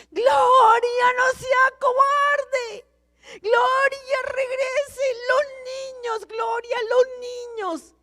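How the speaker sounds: high desperate shout, near tears; husband shouting. Retold or imitated. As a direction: cry out her name to call her back. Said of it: "mi marido está gritando". (grito desesperado casi llorando)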